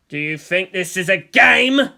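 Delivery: loud, slow menacing snarl; slow, loud threat to his son. Acted. Gendo threatening Shinji after hitting him, a loud slow snarl. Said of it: "Gendo snarled out".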